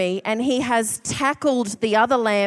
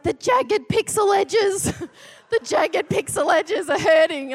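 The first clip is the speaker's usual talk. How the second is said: whiny mock plea; 7 semitones higher than usual. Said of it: "he's like".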